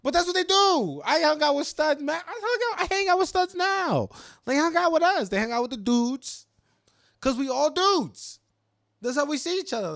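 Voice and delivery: high, loud, fired-up protest; loud heated insisting. Real, not acted. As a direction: shout it out, defending your crew. Heated argument about studs.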